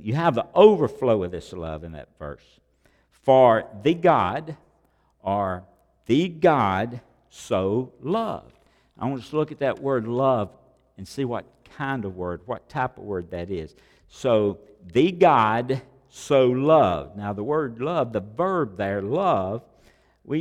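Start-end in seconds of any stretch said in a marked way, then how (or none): 3.1-8.6 slow verse reading. Acted. Dwells on verse word by word. 14.9-17.2 slow verse reading. Acted. Dwells on verse again.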